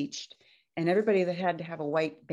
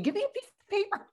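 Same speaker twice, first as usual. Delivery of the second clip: retold scream; faster than usual. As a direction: squeal the demand up high like a frantic patient. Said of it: "I'm, like, screaming".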